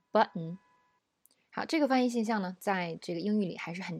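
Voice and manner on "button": American button with a glottal stop; American English. Shown as a demonstration. Teacher reads button in an American accent. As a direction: button said with the t cut off in the throat. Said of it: "再读美音".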